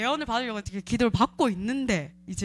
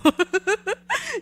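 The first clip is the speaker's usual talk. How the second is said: high laugh; 11 semitones higher, 5 dB louder than usual. Real, not acted. Laughing about waking up.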